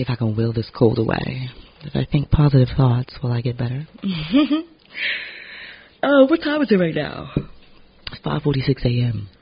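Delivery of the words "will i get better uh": usual speaking voice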